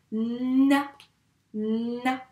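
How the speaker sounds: "na" with a long held n; dictated syllable. Shown as a demonstration. Teacher dictates the first syllable to pupils, sounded out. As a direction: stretch the n of "na" for dictation. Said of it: "c'est la syllabe".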